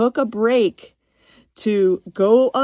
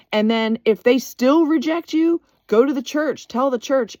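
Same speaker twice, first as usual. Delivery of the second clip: higher, pressing each step home; raised preaching emphasis; 6 semitones higher than usual. Retold or imitated. Retelling how she rebuked the gossiping worker, raised and insistent. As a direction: build the rebuke like a sermon point. Retelling her firm confrontation.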